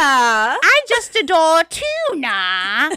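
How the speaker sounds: slow, drawn-out posh British line; posh British accent. Shown as a demonstration. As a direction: ham up an English girl adoring tuna. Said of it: "trying to do accents".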